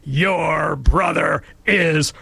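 slow, drawn-out, stern verdict; haughty schoolteacher overruling a first grader; imperious teacher. Retold or imitated. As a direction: lay down the law to a cheeky child. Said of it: "said in an imperious tone".

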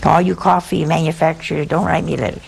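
normal talking voice

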